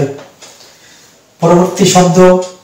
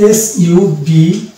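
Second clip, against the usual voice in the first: DBT spelled out very slowly, letter by letter; spelling out letters; much slower than usual. Shown as a demonstration. Teacher spelling a word for students, very slowly. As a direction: draw out each letter of DBT. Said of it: "তারপরে পেয়ে যাব".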